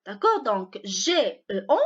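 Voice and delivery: normal talking voice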